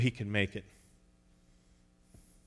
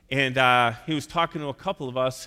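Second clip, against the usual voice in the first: ordinary delivery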